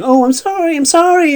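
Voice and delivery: high, loud imitated sobbing apology; mock bawling. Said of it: "She's bawling and she's saying".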